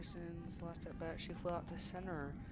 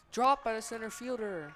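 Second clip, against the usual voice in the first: excited call; 4 semitones higher, 13 dB louder than usual. Real, not acted. Error on a fly ball.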